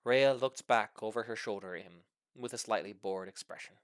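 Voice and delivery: usual speaking voice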